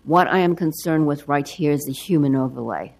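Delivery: ordinary talk, nothing marked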